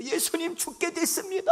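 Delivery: high, loud frantic shout; mock panic. Disciples shake Jesus awake in a storm, high panicked shout. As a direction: cry out in panic, half laughing.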